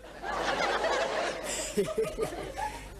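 high-pitched giggling cackle. Shown as a demonstration. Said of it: "But I bet he can't do this".

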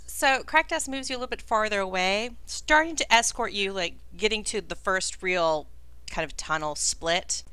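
ordinary talk

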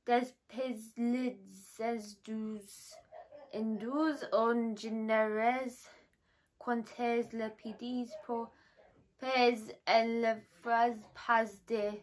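slow, badly pronounced French; halting attempted French. Real, not acted. Vlogger reading French off foot cream label, halting attempted French. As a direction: sound out French word by word, unsure. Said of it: "I think this is French".

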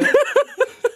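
high, bursting real laugh; real laughter. Real, not acted. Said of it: "laughing at own line".

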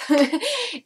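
quick amused laugh; bright laugh. Real, not acted. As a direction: laugh at the memory. Laughs at own story.

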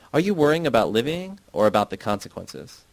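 ordinary delivery, nothing marked

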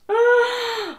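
high, loud drawn-out gasp; shocked gasp. Real, not acted. Reader seeing her quiz score revealed, shocked gasp. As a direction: gasp sharply at the result.

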